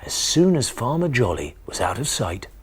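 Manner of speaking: plain reading voice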